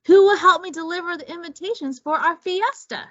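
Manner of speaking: busy hostess asking for help; eager lizard. Acted. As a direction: rush the question, keen to get going. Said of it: "Iguana asked".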